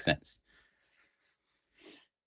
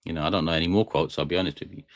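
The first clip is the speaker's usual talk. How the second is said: ordinary talk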